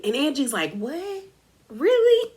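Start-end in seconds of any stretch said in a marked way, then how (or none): 0.7-1.2 soft, high, rising what; incredulous. Retold or imitated. Retold Angie reacting in disbelief. 1.7-2.3 very high-pitched mock disbelief; incredulous. Retold Angie's disbelieving reaction.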